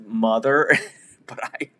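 usual speaking voice

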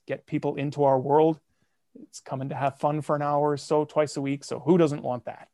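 ordinary talk

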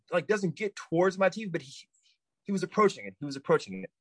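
ordinary delivery, nothing marked

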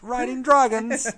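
high, loud, slow posh British exclamation; hammed-up British cry about dragons; British accent. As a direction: belt it out like a pompous Brit. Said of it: "very British".

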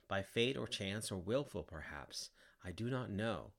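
plain reading voice

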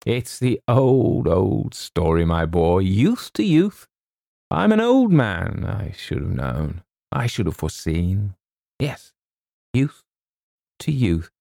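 drawn-out, noble self-pity; wistful old Colonel. A narrator's reading. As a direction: lament like an ageing suitor giving way.

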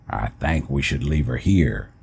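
put-on an old uncle gently but firmly saying no. A narrator's reading. Old uncle refusing to bring the dog, low and firm.

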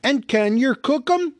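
high, loud troll voice; a troll thinking only of dinner; hungry troll. Acted. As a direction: Tom the troll asks about cooking him. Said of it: "said Tom".

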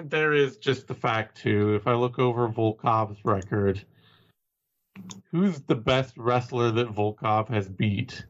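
ordinary talk, nothing marked

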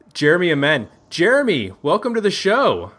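usual speaking voice

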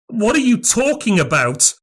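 high, alarmed cry of horror; horrified. A narrator's reading. Craven horrified by the stranger's hint, pitch shooting up. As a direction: recoil as the meaning dawns. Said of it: "Craven said with a horror".

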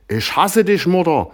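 very high, loud screech; furious daughter shrieking. Acted. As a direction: scream it at her mother. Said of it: "kreischte Marion".

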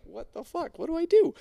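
high, squeaky panicked outburst; mock panic rising to a yelp; panicked disbelief. Diver seeing his gear vanish, in panic. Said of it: "so I'm like".

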